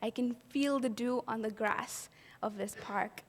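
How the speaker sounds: normal talking voice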